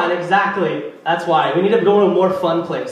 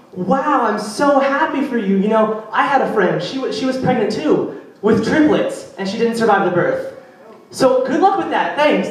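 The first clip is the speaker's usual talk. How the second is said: higher, mock-sweet congratulation; chirpy, oblivious story topper; fake cheerful; 4 semitones higher than usual. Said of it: "I'm like".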